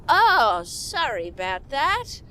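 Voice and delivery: a hint of a slow rural drawl; lightly voiced country bumpkin, apologetic; mock country accent, lightly done; country yokel voice. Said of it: "how country people sound like to me".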